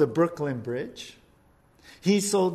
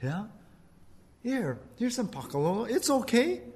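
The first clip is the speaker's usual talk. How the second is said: lightly voiced sly tempter; Satan tempting; 5 semitones higher than usual. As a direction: sell it gently, a little sly. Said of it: "or he says".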